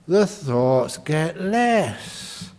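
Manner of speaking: very slow, drawn-out words. Shown as a demonstration. Teacher describes the mind slowing down, very slowly. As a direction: stretch each word out unhurriedly.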